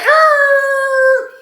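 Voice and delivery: long, very high steady wail; imitated alarm noise. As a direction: mimic an alarm going off, held up high. Imitates noise as police come.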